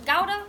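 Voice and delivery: "Gouda" said as GOW-duh; "Gouda" with first syllable rhyming with "cow"; alternative pronunciation. Shown as a demonstration. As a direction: say "Gouda" with an ow vowel and hard g. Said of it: "how do you guys pronounce this word".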